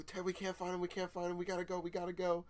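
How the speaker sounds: high-pitched mock panic, not a full scream; imitated panicked screaming. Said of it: "They're screaming at you".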